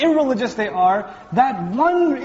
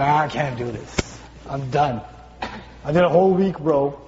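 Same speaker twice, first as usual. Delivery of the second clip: low, mildly defeated quitter; a touch of worn-out surrender, pitched low; giving up; 7 semitones lower than usual. Acted. Workout dropout quitting after a week, defeated.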